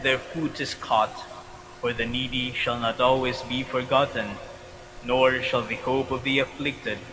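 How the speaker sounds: ordinary talk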